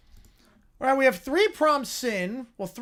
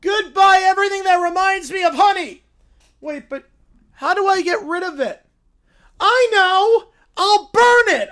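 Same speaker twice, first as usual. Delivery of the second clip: high, loud, triumphant cub; little bear vowing loudly; excited bear shout; 10 semitones higher, 11 dB louder than usual. Acted. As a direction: yell it like a sudden revelation. Bear plans to burn.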